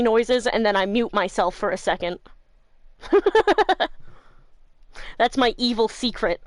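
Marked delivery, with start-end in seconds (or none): 3.0-4.1 giggle. Real, not acted. Laughs at her snuffly secret.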